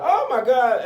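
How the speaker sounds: high, loud mock shock; acted horror. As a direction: exclaim like a horrified listener. Acting staff's shock at deaths.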